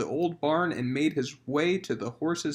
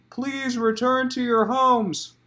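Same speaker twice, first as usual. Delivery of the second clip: announcing; 6 semitones higher, 3 dB louder, slower than usual. Acted. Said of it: "announced the Archduke".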